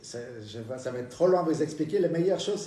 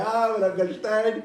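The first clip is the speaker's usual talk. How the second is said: gloating banker; 5 semitones higher, 3 dB louder than usual. Acted. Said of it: "il a la banane".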